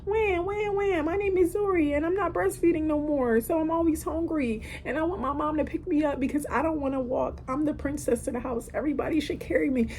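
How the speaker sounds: put-on high, babyish toddler voice; mother imitating her demanding toddler daughter, high and babyish; Zuri baby voice. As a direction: play the spoiled little princess of the house.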